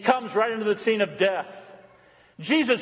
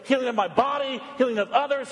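ordinary talk

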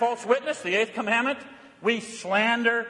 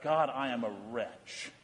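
quiet contrite plea; 11 semitones lower, 10 dB quieter than usual. Acted. Contrite heart crying out to God.

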